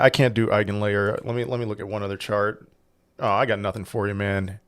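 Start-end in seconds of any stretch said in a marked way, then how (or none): none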